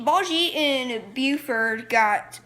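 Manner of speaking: ordinary talk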